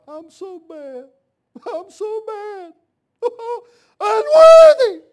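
high, drawn-out mock wail building to a loud cry; mock humble testimony. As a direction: wail it like an over-humble penitent, ending in a huge howl. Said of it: "humble Baptist Christians stand up and testify".

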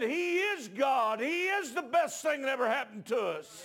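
loud pulpit shout, words stretched out; full-force preaching. Real, not acted. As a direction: cry out the truth to the congregation.

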